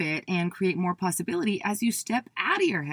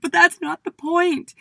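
upset protest; 10 semitones higher, 6 dB louder than usual. Retold or imitated. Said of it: "I started bawling my eyes out".

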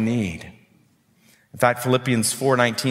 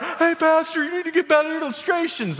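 much higher mock heckle at the pastor; wife pretending to yell from the stands; mock shout. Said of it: "if I shouted out".

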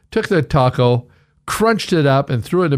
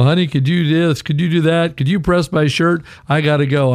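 lightly voiced husband, higher and sweet-talking; husband asking favors; 5 semitones higher than usual. Husband sweet-talking his wife into chores. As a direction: play the needy husband asking for help.

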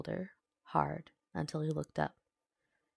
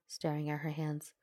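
usual speaking voice

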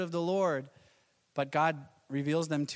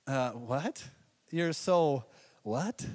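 slow, puzzled what; drawn-out confused question; baffled; 3 semitones lower, much slower than usual. Retold or imitated. Young [name] baffled by his girlfriend's slang. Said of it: "I said".

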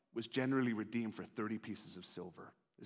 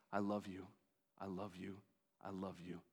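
a soft I love you, fading softer each time; tender repeated love; 4 semitones lower, 8 dB quieter than usual. Acted. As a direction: let God's love fall to a near hush.

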